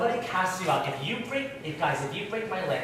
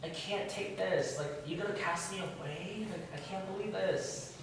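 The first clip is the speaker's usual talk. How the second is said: drawn-out, soft, higher-pitched whine; mock whining; 4 semitones higher, 5 dB quieter than usual. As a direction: whine in self-pity, imitating a complainer. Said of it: "Cain is whining".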